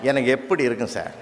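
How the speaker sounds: normal talking voice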